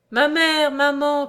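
high, loud call to a mother; crying out. A narrator's reading. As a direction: cry out, throwing yourself into her arms. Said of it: "cria Charles en se jetant dans les bras". (cri d'un fils vers sa mère)

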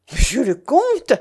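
loud, high cry of protest; indignant exclamation. A narrator's reading. Young woman challenges the false count, exclaiming. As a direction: cry the title back in outrage. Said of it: "s'écria Mélanie".

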